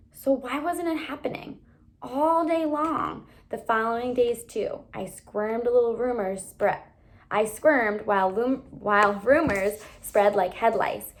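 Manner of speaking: ordinary delivery, nothing marked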